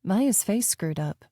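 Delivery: ordinary delivery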